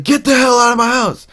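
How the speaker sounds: high, loud retold yell; angry yell. Manicloud ordering him out, high angry yell.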